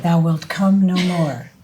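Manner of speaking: slow, grave Shakespeare reading; dramatic recitation. Acted. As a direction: recite it like a tragic actor. Recites King Lear.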